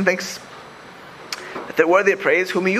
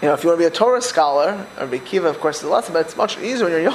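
ordinary talk, nothing marked

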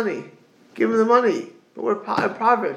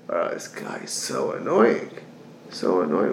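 grumbling; 12 semitones lower than usual. Retold or imitated. Grumbling about the borrower.

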